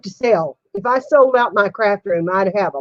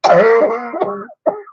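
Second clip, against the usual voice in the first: real burst of coughing; higher, helpless cough after laughing; coughing fit; 4 semitones higher, 4 dB louder than usual. Real, not acted. Woman losing her breath on stream, coughing fit. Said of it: "I'm gonna have a coughing fit".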